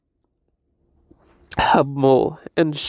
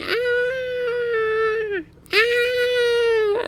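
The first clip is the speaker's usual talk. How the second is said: high kitten cries. Retold or imitated. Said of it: "he started going".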